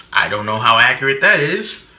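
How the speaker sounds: ordinary talk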